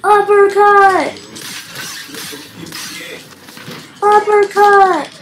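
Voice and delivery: loud, high, stretched battle call; calling out an attack. Pretend. Player announcing a fighting move in game, calling out. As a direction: shout the move's name in one long cry.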